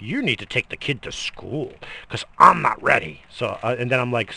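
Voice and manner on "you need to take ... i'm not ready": loud impatient wife; snapping wife. Retold or imitated. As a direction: order him around, snippy. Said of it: "She's like".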